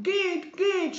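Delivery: high, loud dismissal; tearful scream. A narrator's reading. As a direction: cry out and drive them away. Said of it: "schrie er".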